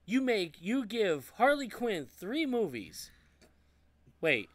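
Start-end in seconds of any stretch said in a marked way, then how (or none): 0.1-3.1 high, drawn-out exasperation on each key word; indignant rant. Real, not acted. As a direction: stretch the words in loud-edged disbelief. Ranting about missing sequel.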